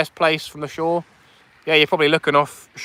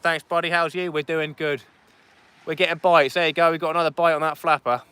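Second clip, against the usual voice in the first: ordinary delivery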